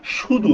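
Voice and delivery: শুধু with a clear sh, 'shudhu'; sh sound. Shown as a demonstration. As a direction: open শুধু on a hushing sh. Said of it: "এস এইচ এর মতো উচ্চারণটা". (শুধু-র শুরুতে sh-এর মতো তালব্য শ)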